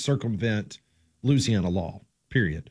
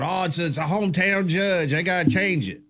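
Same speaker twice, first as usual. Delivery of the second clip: mock yell; 5 semitones higher than usual. Host mimicking outraged America over a hometown judge, mock yell. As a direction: imitate a public uproar without really yelling. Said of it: "They yelled".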